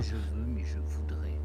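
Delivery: breathy whispered hook. Acted. Podcast intro hook, whispered. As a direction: whisper the catchphrase close to the mic.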